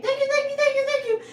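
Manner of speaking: high, loud rapturous thank-yous over and over; ecstatic thanks. Retold or imitated. Calling out thanks to the trees, high and loud. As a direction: call out your thanks, overjoyed.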